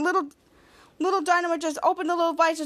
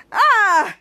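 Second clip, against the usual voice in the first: short cry of pain; 5 semitones higher, 7 dB louder than usual. Retold or imitated. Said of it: "it's like".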